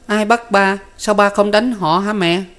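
put-on very high, little toddler questioning; small child's voice. A narrator's reading. Toddler asks mother about father's arrest, small child's voice. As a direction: pitch it way up like a puzzled child. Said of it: "nghe con hỏi càng đau lòng".

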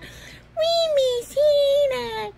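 high wailing toy voice; pretend crying. Toy frog missing Hannah, high pretend wail. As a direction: whine it slowly like a squeaky puppet.

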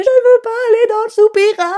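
put-on very high, loud, wailing little girl; imitating a crying daughter refusing; crying child. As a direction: wail it like a sobbing kid. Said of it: "qui se met à pleurer".

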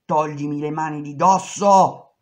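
loud furious order; shouting. Retold or imitated. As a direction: yell at him to let go. (urlo furioso)

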